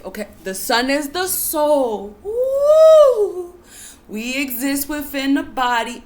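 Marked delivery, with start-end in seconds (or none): 2.2-3.5 high, loud ooh of excitement; excited whoop. Real, not acted. Speaker thrilled by own teaching, high excited whoop. Exclaims after key phrase.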